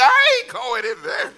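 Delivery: high, slow, laughing protest; laughing refusal. Retold or imitated. Teen boy refusing the Holy Spirit, high laughing protest. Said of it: "I said".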